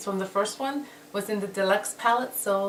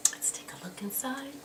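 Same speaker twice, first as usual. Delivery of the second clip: soft voiceless whisper, much softer; 5 semitones higher, 12 dB quieter than usual. Real, not acted. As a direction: lean in and whisper the reveal. Hushed peek inside palette.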